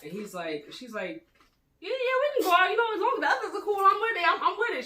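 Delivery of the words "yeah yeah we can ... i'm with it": much higher, put-on female voice; high-pitched imitation saying yes; eager acceptance. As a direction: act out her chirpy agreement. Voices her breezy, unsuspecting acceptance.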